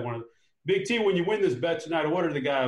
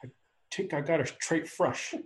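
put-on Fargo accent; 4 dB quieter than usual. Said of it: "Fargo goes something like".

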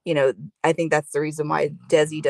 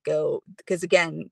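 usual speaking voice

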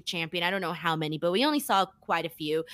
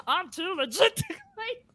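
high, slow mock Irish accent; goofy Irish impression of a wrestler; bad Irish accent; 10 semitones higher than usual. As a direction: drag out a badly faked Irish accent. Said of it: "That's my Irish accent".

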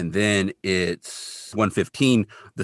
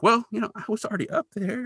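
high, softer, defensive imitation; mock sheepish excuse; 12 semitones higher, 5 dB quieter than usual. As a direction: make a feeble excuse in a high voice. Kolchak making excuses to his boss.

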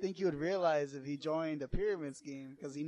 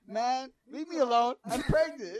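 annoyed loud; 9 semitones higher, 11 dB louder than usual. Retold or imitated. Sister voiced, annoyed.

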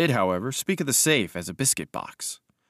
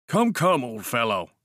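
cried out; 2 semitones higher than usual. Acted. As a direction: call out to an old friend. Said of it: "he cried".

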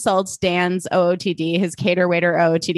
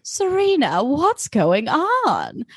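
higher, slow drawn-out Lily impression; 6 semitones higher, much slower than usual. Podcaster redoing Lily's line in her voice. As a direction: stretch it out slowly as Lily. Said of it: "it's just a whisper of a difference".

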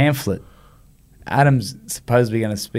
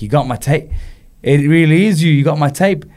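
building to loud, higher excitement; starstruck, rising into loud amazement; thrilled disbelief; 5 semitones higher, 6 dB louder than usual. Retold or imitated. Retold thrill at hero's phone call.

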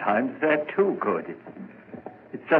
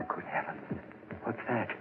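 shocked whisper; 17 dB quieter, faster than usual. Acted. Hears a phantom heartbeat, alarmed.

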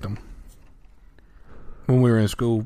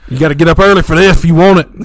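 mock yell; 6 semitones higher, 7 dB louder, faster than usual. Voicing dad.

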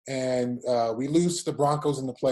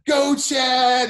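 high, loud imitated cheer; fans' cheer; 8 semitones higher, 6 dB louder than usual. Fans greeting a returning player, loud cheer. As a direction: call out like a crowd of fans.

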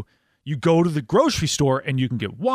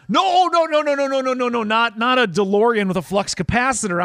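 mock frantic correction; 6 semitones higher than usual. Frantic correction of gas station clerk.

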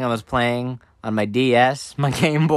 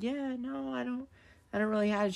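high, soft, evasive denial; coy kid dodging the question; playing coy; 11 semitones higher, 12 dB quieter than usual. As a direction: play innocent in a small high voice. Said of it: "I'm playing a little bit coy".

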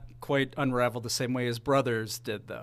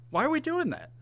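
"Why are we doing that?" asked much higher, in disbelief; exasperated repeat; 10 semitones higher than usual. Real, not acted. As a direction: throw the question up high in exasperation.

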